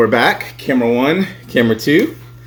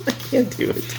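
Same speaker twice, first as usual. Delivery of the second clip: helpless laughing squeak; 13 semitones higher than usual. Real, not acted. Host cracking up at his own Yelp bit, laughing.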